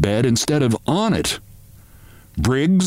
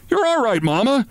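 shouted; 9 semitones higher than usual. A narrator's reading. Son shouting to his hysterical mother, high shout. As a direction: shout it up high to calm her down. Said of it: "he shouted".

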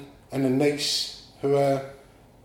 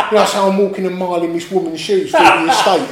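words spilling through laughter, higher and louder; laughing while talking; 5 semitones higher, 8 dB louder than usual. Real, not acted. Amused storyteller laughing to the interviewer. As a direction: keep laughing as you explain.

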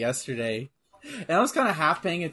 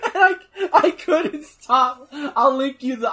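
laughing; 8 semitones higher, 7 dB louder, slower than usual. Real, not acted. Laughing hard at the clip.

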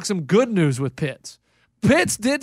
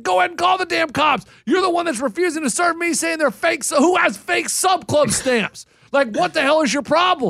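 high-pitched, indignant retold yell; raised-voice yelling; 8 semitones higher than usual. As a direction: yell back at the clerk in outraged protest. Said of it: "I raised my voice and yelled at him".

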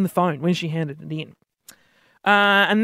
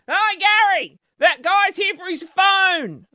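calling out; 11 semitones higher, slower than usual. Retold or imitated. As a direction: shout it slowly to the back room. Staff calling out across office.

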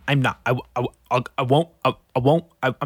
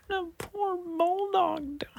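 mock crying whisper; 6 dB quieter, slower than usual. Real, not acted. Grieving bulldog news.

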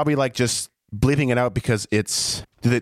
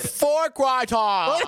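very slow, high mock British accent; hammed-up British wail; 9 semitones higher, much slower than usual. Shown as a demonstration. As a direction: stretch it out in silly, over-the-top British. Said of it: "do it with more British accent".

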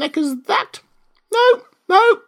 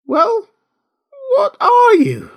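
high, loud pigeon challenge; shrill demand; 9 dB louder, slower than usual. Acted. Pigeon interrogates a stammering Alice, demanding. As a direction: demand an answer in a screech.

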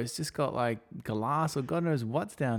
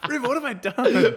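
laughing panic; 14 semitones higher, 6 dB louder than usual. Acted. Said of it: "Looked over at Reva".

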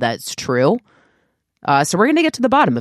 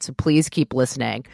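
ordinary talk

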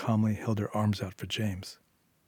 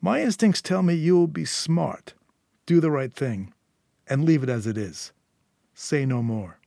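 menacing gang boss; 5 semitones higher than usual. A narrator's reading. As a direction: lecture her like a patient but dangerous boss. Eddie warns Julia.

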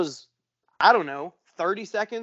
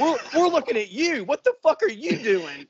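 high-pitched, baffled comeback; mock indignant onlookers, voice pitched up; retold incredulous retort; 10 semitones higher than usual. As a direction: throw the question back, amused and incredulous. Onlookers retort, played for laughs.

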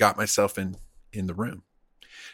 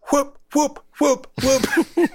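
whoop sounds; 13 semitones higher, slower than usual. Retold or imitated. Downing the drinks fast.

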